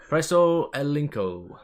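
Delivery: slow, loud fake Spanish accent; mock Spanish accent. Joking to chat to press the link, hammed-up Spanish accent. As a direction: ham up a Spanish accent to sell the joke. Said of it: "If you say it in a Spanish accent".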